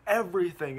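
high, loud one-word shout; sharp retort. Retold or imitated. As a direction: fling the answer back in his face. Peter answers the villain.

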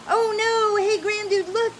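high, loud cry of alarm; alarmed yell. Acted. Lucy spotting the avalanche through the telescope, yelling. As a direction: warn everyone of the danger. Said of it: "yelled Lucy".

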